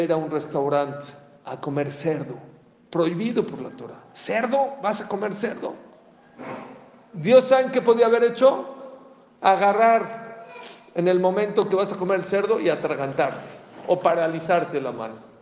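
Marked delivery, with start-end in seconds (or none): 4.1-5.7 high, incredulous mock gasp at the pork; shocked preacher voice; mock astonishment. Rabbi mocks the pork eater. 7.1-15.3 loud, raised sermon cry; rally the congregation, louder and higher; fiery preacher climax; preaching at full force. Acted.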